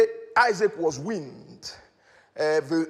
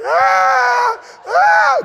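loud high-pitched mock wail; exaggerated bawling, played for laughs; imitated crying; 12 dB louder than usual. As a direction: wail like a big baby, hammed up. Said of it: "And he's crying".

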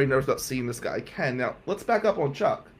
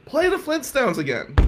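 hyped-up call-out; 10 semitones higher than usual. Retold or imitated.

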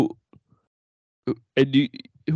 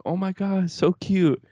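put-on gushing girls; 9 semitones higher, slower than usual. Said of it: "girls coming up to you like".

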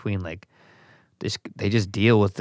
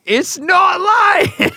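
high, loud, very slow mock echo; mocking shout; 6 dB louder, much slower than usual. Real, not acted. Friend mocking his denial, high drawn-out yell.

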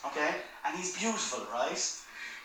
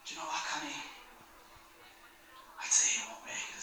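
hushed taunt; 3 semitones lower, 10 dB quieter than usual. Retold or imitated. Acted low aside to boy in goal.

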